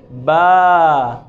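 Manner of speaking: बा with the a held for two beats; long syllable demo. Shown as a demonstration. Teacher showing the doubled vowel in बा. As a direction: stretch बा to double length. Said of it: "दो हरकत के बराबर होगी".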